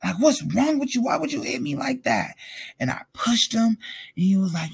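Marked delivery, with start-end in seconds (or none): 0.0-2.4 higher-pitched mock outrage, no shouting; retold enraged outburst. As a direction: replay an indignant confrontation, pitch jumping up. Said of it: "I'm enraged".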